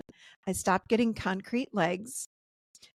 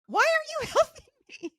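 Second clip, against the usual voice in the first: high-pitched mock yell; retold husband's yelling; 3 dB louder, faster than usual. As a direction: imitate a husband yelling, voice shooting way up. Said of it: "And he's yelling at".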